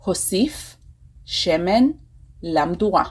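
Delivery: teaching repeat with a pause after each word. Shown as a demonstration. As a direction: separate each word for the learners. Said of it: "I will say it again".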